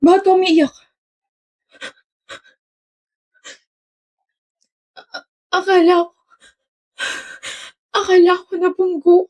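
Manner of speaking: put-on high, tearful voice of a frightened girl; a hint of crying, retold. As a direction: choke out the words as if about to cry. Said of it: "naiyak ka pa nga".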